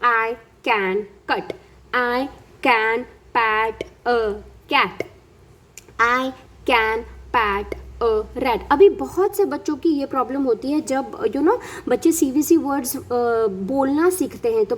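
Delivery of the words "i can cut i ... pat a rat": each word said very slowly and separately; slow model reading. Shown as a demonstration. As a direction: read it word by word, very slowly, for a child. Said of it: "next level पे बच्चे को सिखाएंगे".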